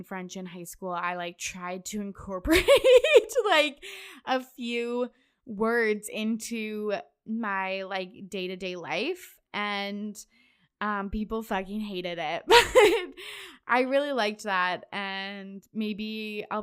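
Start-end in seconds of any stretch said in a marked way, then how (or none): 2.7-3.4 sudden high burst of laughter. Real, not acted. Laughs at own French habit. 12.5-13.6 loud high laugh. Real, not acted. Laughs after people hated it.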